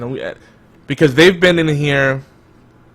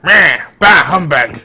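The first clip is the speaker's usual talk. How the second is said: put-on loud, grumbling old-man voice; a grumpy Scrooge with a loud "bah" and "humbug"; 3 semitones higher, 6 dB louder, slower than usual. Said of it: "grumpy old man of the network".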